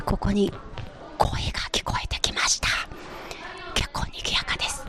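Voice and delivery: hushed, whispered on-site commentary; quiet whispered report. Real, not acted. Reporter hears voices from live studios, whispering quietly. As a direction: whisper it so the broadcast isn't disturbed. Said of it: "静かにリポートしていきたい".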